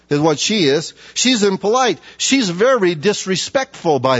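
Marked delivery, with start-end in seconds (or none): none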